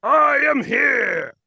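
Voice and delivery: very high, slow, roaring declaration; booming hero shout. Acted. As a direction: bellow it like a cartoon hero. Impostor repeats catchphrase louder.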